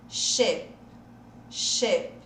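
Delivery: Che with a short closed ê; E of escola. Shown as a demonstration. Teacher puts escola's vowel into the word, closed ê. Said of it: "De escola".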